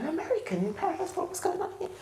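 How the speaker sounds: baffled to hear an American here; puzzled surprise. Retold or imitated. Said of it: "I'm like".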